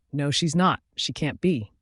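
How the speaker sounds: ordinary delivery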